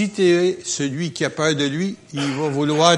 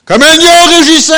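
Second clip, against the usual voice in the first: much higher and louder, like a lion's roar; roaring proclamation; 13 semitones higher, 17 dB louder than usual. Real, not acted. Preacher roars the lion verse to the congregation, full voice.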